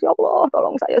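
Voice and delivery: high, tearful cry to God; crying prayer. Retold or imitated. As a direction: beg God for help through tears. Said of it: "Saya menangis".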